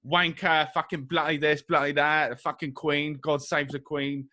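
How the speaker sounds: mock British cursing; British accent. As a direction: rattle off stock British slang in accent. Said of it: "like, a British accent".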